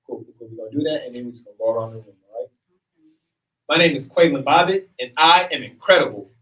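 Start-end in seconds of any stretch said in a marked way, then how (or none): 3.7-6.3 loud, higher-pitched proud declaration; proud affirmation. Real, not acted. Leader opening the affirmation round for the group, loud and proud. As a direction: project it boldly to the whole room.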